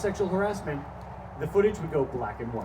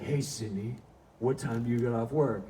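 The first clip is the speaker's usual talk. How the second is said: lower, ominous same line; menacing harasser; 4 semitones lower than usual. Retold or imitated. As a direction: make the question sound creepy.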